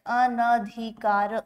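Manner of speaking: अनधिकार said slowly, syllable by syllable; slow dictation. Shown as a demonstration. Teacher writes the word for students, slowly. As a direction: stretch अनधिकार as you write. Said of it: "अनधिकार ऐसा लिखा जाता है".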